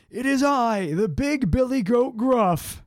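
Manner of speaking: high, drawn-out big goat voice; ugly hoarse goat. A narrator's reading. Big goat answering the troll, ugly hoarse voice. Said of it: "had an ugly hoarse voice".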